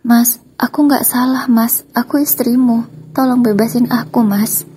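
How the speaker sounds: high, loud desperate begging wife; pleading ex-wife. Acted. Ex-wife begs husband at police station, high desperate pleading. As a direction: beg him to free you, voice pitched high.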